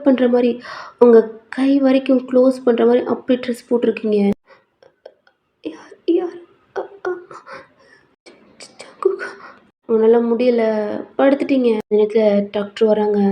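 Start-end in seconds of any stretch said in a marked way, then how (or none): 5.6-9.3 high, very soft breathy murmur; weak sick-girl whisper; delirious whisper. Acted. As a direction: mumble feebly in a fever.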